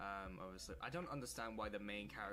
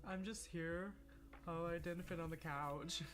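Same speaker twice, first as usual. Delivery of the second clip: high put-on voice for a left-out character; higher comic voice for the sidelined drawing; mock Chica voice; 9 semitones higher than usual. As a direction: voice Chica as if she meekly shrugs. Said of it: "is just stood like".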